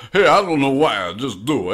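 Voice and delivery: slurred tough-guy bulldog voice; a drunk Stallone growl; drunk Sylvester Stallone. Acted. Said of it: "like a drunk Sylvester Stallone".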